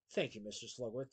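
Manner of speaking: low, soft thanks; subdued thanks. A narrator's reading. Chief thanking the man who bribes him, low and subdued. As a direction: drop down and thank him humbly.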